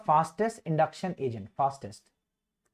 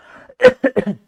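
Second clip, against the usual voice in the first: loud cough; 8 semitones higher, 14 dB louder than usual. Real, not acted. Loud cough mid-lecture.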